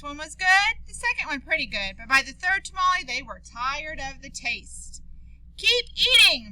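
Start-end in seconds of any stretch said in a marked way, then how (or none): 5.6-6.5 sharp scold, higher and louder. Acted. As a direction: scold the cousins to keep going. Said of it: "Maria scolded".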